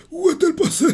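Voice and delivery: put-on high, thin old man's voice. [name] turned grandpa searches for Bonnie, old man voice. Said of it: "j'essaie d'imiter la voix d'un papy".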